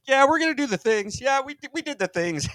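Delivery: high-pitched silly crowd voice; mock confession in a high comic voice; people admitting it. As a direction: answer way up high, blithely unrepentant. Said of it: "it's like".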